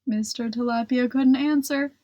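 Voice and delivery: usual speaking voice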